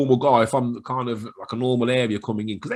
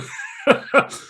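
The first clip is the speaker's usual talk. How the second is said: high laugh; 14 semitones higher, 6 dB louder than usual. Real, not acted. Laughing at own impression.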